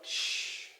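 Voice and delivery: long voiceless "sh" hiss; isolated sibilant sh; drawn-out sh sound. Shown as a demonstration. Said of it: "It gives it the sound".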